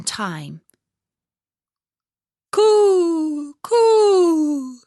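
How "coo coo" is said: high cooing bird calls; cooing pigeons. Acted. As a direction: coo like a wood pigeon, high and loud. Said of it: "said the wood pigeons".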